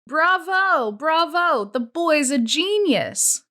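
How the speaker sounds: high, slow, sarcastic applause; mocking praise. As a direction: gush over him with open scorn. Pendanski mocks Zero.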